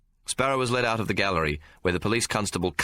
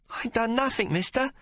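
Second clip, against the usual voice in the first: put-on much higher whiny boy's plea; urchin whining. Sparrow whining to the stranger, high and pleading. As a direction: plead your innocence with a whine. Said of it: "Sparrow whined".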